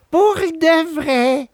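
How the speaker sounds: slow high echo; the blind cat repeats the fox, slow. A narrator's reading. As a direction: parrot the fox's words.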